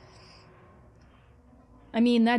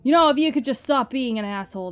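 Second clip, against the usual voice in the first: annoyed; 5 dB louder, faster than usual. Real, not acted. Yelling at game.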